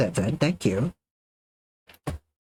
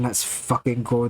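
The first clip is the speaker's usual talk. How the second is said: ordinary talk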